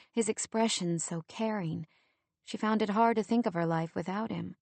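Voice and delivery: usual speaking voice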